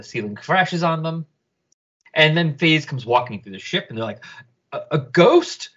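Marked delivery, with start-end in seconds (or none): none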